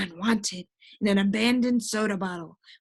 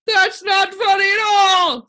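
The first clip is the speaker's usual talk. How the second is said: high, loud, slow wailing protest; sobbing; 10 semitones higher, 10 dB louder than usual. A narrator's reading. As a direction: wail it out, hurt. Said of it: "sobbed the din".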